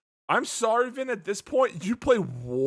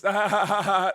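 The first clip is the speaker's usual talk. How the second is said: mock laugh; 5 semitones higher, 5 dB louder than usual. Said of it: "The Rock straight up just laughs".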